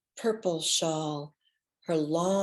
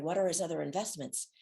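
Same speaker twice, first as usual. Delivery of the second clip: usual speaking voice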